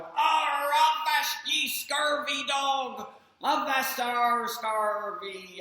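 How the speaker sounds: high, loud, slow hammed-up pirate; bellowing pirate. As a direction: boom it out like a toy pirate. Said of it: "that bellowed".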